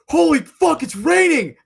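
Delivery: high, loud yell of alarm; startled yelling. Retold or imitated. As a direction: imitate a friend jolting awake and yelling. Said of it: "just sat up and started yelling".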